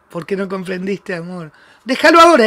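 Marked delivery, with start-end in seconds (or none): 1.8-2.5 challenge. Real, not acted. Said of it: "te desafío".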